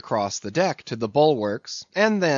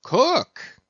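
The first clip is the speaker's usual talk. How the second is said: sharp call; 14 semitones higher, 3 dB louder than usual. Acted. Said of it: "here interposed Stubb".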